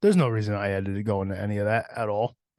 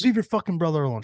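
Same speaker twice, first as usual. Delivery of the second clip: angry mom yell; 6 semitones higher, 4 dB louder, faster than usual. Retold or imitated. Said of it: "She goes".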